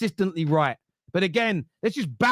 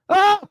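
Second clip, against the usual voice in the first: much higher, louder cry of frustration; much higher, loud frustrated "Ah"; frustrated yell; 12 semitones higher, 7 dB louder than usual. Real, not acted. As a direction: let out a real exasperated shout. Furious about club giving money.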